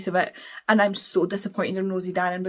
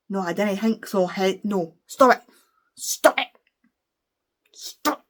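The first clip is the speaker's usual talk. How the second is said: exasperated outburst; slower than usual. Acted. Exasperated at written Scottish dialect.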